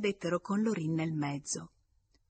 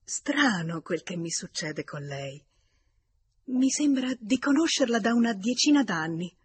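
mildly astonished, building to an exclamation; 4 semitones higher, 7 dB louder than usual. A narrator's reading. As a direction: begin wonderingly, then lift louder and higher. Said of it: "tutta stupita, esclamò".

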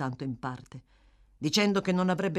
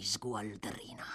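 menacing whisper; 8 semitones lower, 10 dB quieter, slower than usual. A narrator's reading.

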